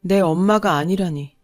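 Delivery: usual speaking voice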